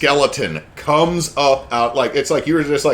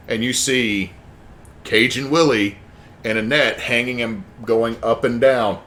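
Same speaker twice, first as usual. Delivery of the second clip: ordinary delivery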